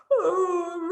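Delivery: a big sleepy yawn. Acted. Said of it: "Night Monkey yawned".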